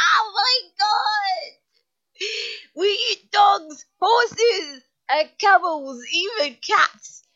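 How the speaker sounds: high, loud tearful cry of dismay; mock-horrified wail. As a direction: wail it through helpless laughter. Wails at message about eating animals.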